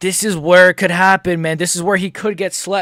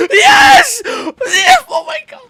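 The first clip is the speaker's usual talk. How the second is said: sobbing victory scream, much higher and louder; crying shout of joy; 5 dB louder, much slower than usual. Real, not acted. Fan celebrating Alex's win, crying and screaming. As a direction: scream yes through happy tears.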